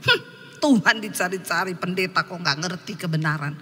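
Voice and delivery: low, understated retold sneer; arrogant scorn. Drunk man laughing at a pastor, mildly arrogant scorn. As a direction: imitate a smug scoffer. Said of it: "menertawakan saya dengan kesombongannya".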